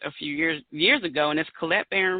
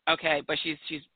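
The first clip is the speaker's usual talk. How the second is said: ordinary talk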